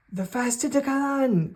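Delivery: high excited cry of the beast's name; calling out. Acted. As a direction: call out the name in alarm. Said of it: "calls out, uh, Igra".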